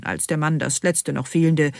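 ordinary delivery

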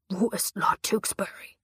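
menacing rasping whisper; hissed demand. Acted. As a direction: hiss it like a threat. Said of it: "zischte er".

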